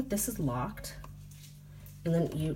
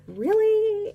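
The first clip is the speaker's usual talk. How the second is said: incredulous; 8 dB louder than usual. Retold or imitated. Incredulous reaction to the kids.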